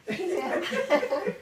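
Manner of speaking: words broken by real laughter, high and loud; can't hold back the giggles; real laughter mid-story; laughing through it. Real, not acted. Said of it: "It's okay to laugh".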